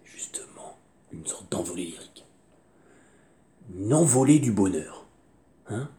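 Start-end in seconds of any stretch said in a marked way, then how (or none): 0.0-2.2 hushed whisper. Real, not acted. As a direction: breathe it softly. Intimate aside.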